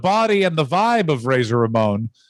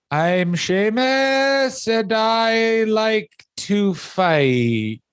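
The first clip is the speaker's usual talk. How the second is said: much higher, very slow mock promo; mock Sheamus promo; 7 semitones higher, much slower than usual. Much higher, very slow mock promo for his cohost. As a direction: drag it out slowly and high as Sheamus. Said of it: "his promos for the first about five years are this".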